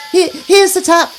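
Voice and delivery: calling out. Acted. Queenie calls to everyone that she has found the tap, loudly. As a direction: shout it across the garden. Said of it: "called Queenie at last".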